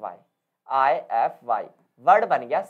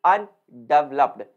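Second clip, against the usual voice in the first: अन in undeveloped hit loud, word drawn out slowly; stress on the prefix; 4 semitones lower, 5 dB louder, much slower than usual. Shown as a demonstration. Teacher showing students prefix stress, अन stressed in undeveloped. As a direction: punch अन, let developed fall away. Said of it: "अन पर देना होता है".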